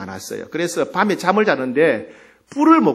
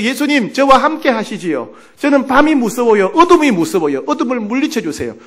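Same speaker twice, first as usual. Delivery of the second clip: raised, pleading petition, acted; earnest prayer; 6 semitones higher, 5 dB louder than usual. Frightened sister prays to Jesus about darkness, pleading earnestly. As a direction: beg for protection from fear. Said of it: "기도했다는 겁니다".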